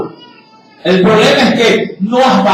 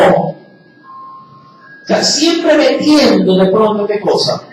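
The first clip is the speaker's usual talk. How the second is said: ordinary talk, nothing marked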